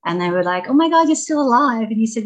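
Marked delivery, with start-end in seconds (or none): 0.7-1.9 shocked reunion. Retold or imitated. Old friends meet in Paris street.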